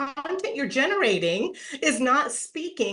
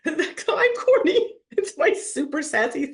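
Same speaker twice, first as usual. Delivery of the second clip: laughing; 8 semitones higher, 3 dB louder than usual. Real, not acted. Host laughing about being corny, high giggling admission. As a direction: laugh it out, owning it. Laughs at her own corniness.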